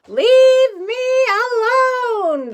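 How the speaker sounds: long, loud, high drawn-out yell; shouted. Acted. Old woman shouting at the moon men. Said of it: "the old woman shouted".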